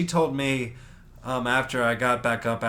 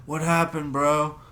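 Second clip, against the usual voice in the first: alarmed bystander question; 4 semitones higher, 4 dB louder, slower than usual. Retold or imitated. Another bystander walks up asking.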